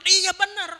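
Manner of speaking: loud, high agreement snapped back; acted quarrel retort. Shown as a demonstration. Acted escalating quarrel over tone. (balasan bertengkar bernada tinggi)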